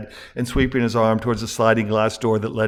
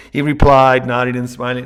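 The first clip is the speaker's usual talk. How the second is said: ordinary delivery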